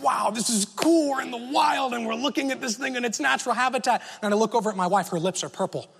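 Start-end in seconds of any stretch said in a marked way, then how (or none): 0.0-4.0 higher, lightly voiced boyish thrill; excited wonder. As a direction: marvel at the bear like a kid at the zoo. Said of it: "part of me is excited".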